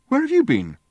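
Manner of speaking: high, loud demand. A narrator's reading. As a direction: bark the question at him. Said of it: "he demanded".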